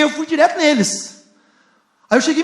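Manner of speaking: normal talking voice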